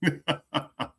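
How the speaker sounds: short run of mocking chuckles; imitated scornful laughter. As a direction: laugh dismissively in imitation of scoffers. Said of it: "they do just like this".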